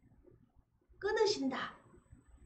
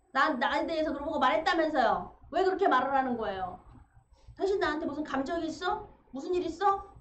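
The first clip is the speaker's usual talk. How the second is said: loud, raised-voice confrontation; 따지는 말투; 4 semitones higher, 5 dB louder than usual. Acted. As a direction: go at them with accusing questions, louder and higher. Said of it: "하면서 막 따지". (언성을 높여 캐묻듯 따지는 연기)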